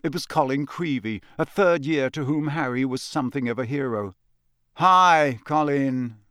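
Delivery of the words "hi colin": stretched, reluctant hello, falling away; louder first word, then deflating; wary greeting. Acted. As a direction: greet the eager fan guardedly. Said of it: "said Harry warily".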